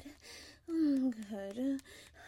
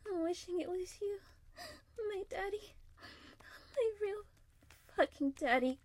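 rising breathless pleading; 7 semitones higher than usual. Acted. Rising toward climax, breaking.

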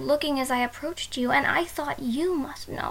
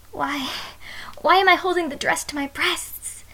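ordinary talk

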